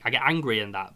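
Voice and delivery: ordinary talk, nothing marked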